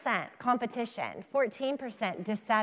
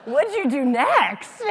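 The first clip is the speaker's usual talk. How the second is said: laughing loudly through the question; 5 semitones higher, 11 dB louder, slower than usual. Real, not acted. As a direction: ask while cracking up. Laughing at dad's story.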